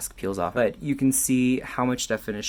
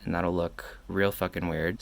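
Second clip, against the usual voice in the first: ordinary delivery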